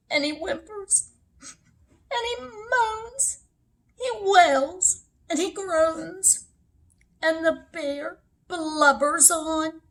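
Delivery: high, whimpering storyteller wail; mock crying. A narrator's reading. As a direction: narrate it in a pretend blubbering whine. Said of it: "the bear's crying".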